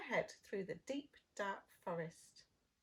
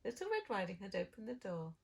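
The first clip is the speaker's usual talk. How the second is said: ordinary delivery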